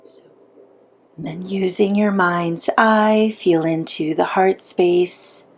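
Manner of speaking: unhurried meditation guide with long pauses; soothing, measured visualization voice; guided meditation. Acted. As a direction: guide them gently inward, leaving long silences.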